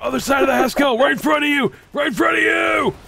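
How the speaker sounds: callout shout. Real, not acted. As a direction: shout the enemy position. Calling out enemy position.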